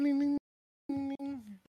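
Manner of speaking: high ringing ding-ding like a little bell; bell chime. Retold or imitated. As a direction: sing the dings like a tiny silver bell. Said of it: "Y'all will hear ding".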